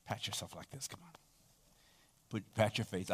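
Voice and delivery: hushed, gentle prompt to pat yourself; urging the congregation softly to pat their faces; whispered instruction. Acted.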